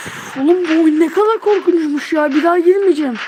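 loud, rattled complaint after a scare; loud shaken rant after a jumpscare; shaken shout. Real, not acted. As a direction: yell it out, still shaken from the fright. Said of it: "kusura bakmayın bağırıyorum".

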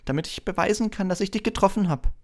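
put-on high-pitched, keen young fan; eager high boy's voice; eager Colin. A narrator's reading. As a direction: plead your case eagerly, high and light. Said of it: "sagte Colin Creevey begierig".

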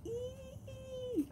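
high thin squeal of delight; excited squeal. Real, not acted. Unboxing a longed-for deck, she squeals excitedly. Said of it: "I'm so excited".

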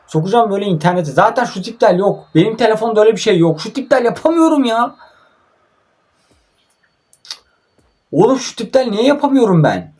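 high, strained, rising frustration; real annoyance building as the move keeps failing; frustrated rant. Real, not acted. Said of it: "Çıldıracağım lan".

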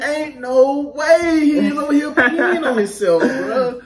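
loud, high, drawn-out disbelief through laughter; laughing disbelief. Retold or imitated. Recruit reacts to a boy peeing himself, laughing in disbelief. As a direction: crack up while exclaiming it.

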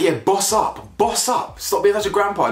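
put-on high, taunting girl voice; mocking girlfriend. Girlfriend taunting her boyfriend to man up, high mock voice. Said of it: "she's just like".

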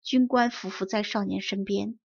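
ordinary delivery

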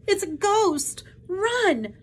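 high, loud squeal of alarm; Piglet's terrified cry. A narrator's reading. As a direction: shriek a warning to flee. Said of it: "cried Piglet".

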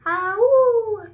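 high, loud wolf howl, ah ooh. Retold or imitated. Teacher showing the kids how a wolf howls. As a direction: throw the head back and howl like a wolf. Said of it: "Wolf goes".

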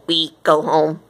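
low odd creature voice; blunt alien farewell. A narrator's reading. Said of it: "the aliens agreed".

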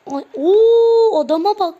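loud, high disbelieving cry; shocked outcry. Real, not acted. Gamer reacts to his player being knocked down, loud shocked cry.

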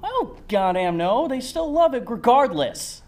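exasperated. Acted. Heated game argument.

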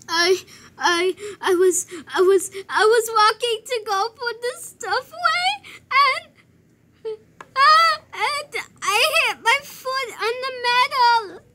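high, loud mock sobbing; crying like a baby. Hurt child explains to the teacher, crying like a baby. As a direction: stammer through hammed-up tears. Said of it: "I was still crying like a baby".

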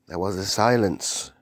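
ordinary delivery

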